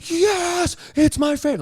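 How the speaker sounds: very high, squealing delighted fan; crazed superfan squeal. Retold or imitated. Superfan girl in the crowd answering the comic, high squeal. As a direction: shriek it like an overexcited fan girl.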